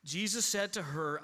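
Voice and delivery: usual speaking voice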